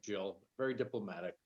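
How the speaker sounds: very soft, hard to hear; barely audible. Real, not acted. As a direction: speak barely above a murmur. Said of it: "Your voice is very quiet".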